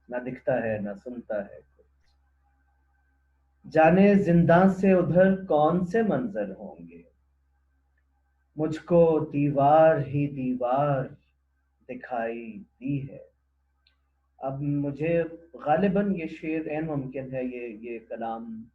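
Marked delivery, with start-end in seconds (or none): 3.7-13.3 high, loud opening falling away to the close; poem recitation. Acted. Presenter reciting a prisoner's couplet to listeners, poem recitation. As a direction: declaim it, then let the last phrase sink.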